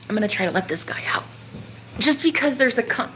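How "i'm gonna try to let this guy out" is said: fast hushed whisper. Real, not acted. Whispering to herself while freeing the moth. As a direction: whisper so the moth doesn't startle.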